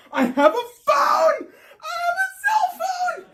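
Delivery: panicked squeal about the cell phone; frantic shout. Retold or imitated. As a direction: shriek it like a lifeline found.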